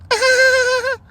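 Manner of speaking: very high squealing goat noise; high squeaky goat cry. Pretend. Farmer mimicking her goat's squeal.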